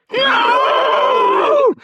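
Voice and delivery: the demon screaming in panic; terrified scream. Acted. Demon inside Rodrigo screaming as it is driven out, terrified shriek. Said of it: "a voice screamed, terrified".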